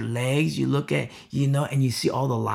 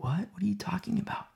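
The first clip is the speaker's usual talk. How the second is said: stunned, breathless whisper; a teen's awed whispered disbelief; shocked whisper; 6 semitones higher, 9 dB quieter, faster than usual. Retold or imitated. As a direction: hush it like a wide-eyed teen in shock. Teen reacting in shocked whisper.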